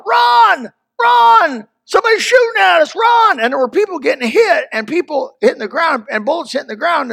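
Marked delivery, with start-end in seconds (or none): 0.1-3.4 high, loud cry of run; echoing a crowd yelling to flee; retold panicked yell. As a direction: shout the warning like fleeing people. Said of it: "people were yelling".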